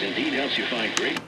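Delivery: ordinary talk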